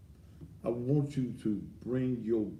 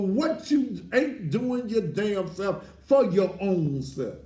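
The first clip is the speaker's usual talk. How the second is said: loud, rising pulpit preaching, hammering each phrase; preaching at full force; 11 semitones higher, 8 dB louder than usual. Acted. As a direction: preach it like the church is on fire.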